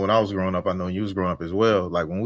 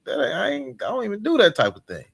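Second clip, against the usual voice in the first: high, pleading denial, voice jumping way up; panicked protest; 13 semitones higher than usual. Pretend. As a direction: plead your innocence in a frantic high voice. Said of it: "You gonna be like".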